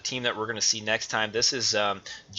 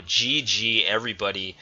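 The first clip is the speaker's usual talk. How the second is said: normal talking voice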